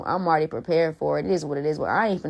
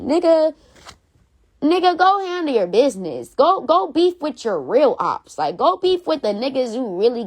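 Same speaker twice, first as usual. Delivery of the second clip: real anger, higher and louder; angry telling-off; 6 semitones higher, 4 dB louder than usual. Real, not acted. Scolding the rapper who dissed her, real anger. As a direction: bark it at him, fed up.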